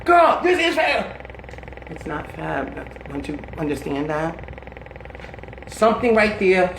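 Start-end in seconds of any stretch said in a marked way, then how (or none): 0.1-1.2 high, drawn-out retold insistence; acted-out excited FAB declaration; emphatic gushing. Retold insisting versus friend's refusal. 1.9-4.4 low, very soft, slow retold denial; acted-out hushed, downcast plea; sad refusal.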